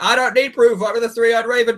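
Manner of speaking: high-pitched portentous seer voice; hammed-up mystic self-importance; mock Bran. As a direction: declare it grandly, pitched well up. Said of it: "He'd be like".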